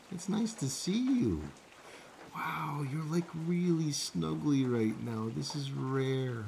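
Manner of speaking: high soft cooing; pet talk. Real, not acted. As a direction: coo lovingly at a snuggly cat. Cooing to his cat.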